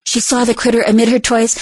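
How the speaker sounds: plain reading voice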